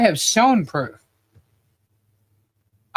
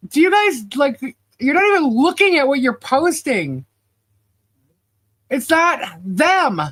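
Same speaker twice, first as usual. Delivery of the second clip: exasperated; 6 semitones higher than usual. Retold or imitated. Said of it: "And I'm like".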